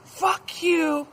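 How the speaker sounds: high-pitched defiant holler; defiant yell. Retold or imitated. Quitting telemarketer to his supervisor, defiant imitated yell. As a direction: hurl it out high and hard. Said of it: "Points at the other supervisor".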